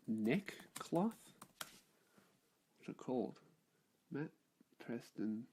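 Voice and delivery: muttering to himself. Real, not acted. Muttering while searching online.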